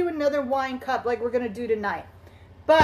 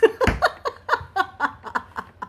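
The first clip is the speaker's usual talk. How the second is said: laughing; 9 semitones higher than usual. Real, not acted. Laughing at her own joke.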